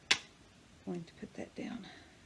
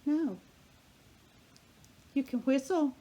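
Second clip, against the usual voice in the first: scolding the pet; 6 semitones higher, 4 dB louder than usual. Real, not acted. Scolding the squawking bird.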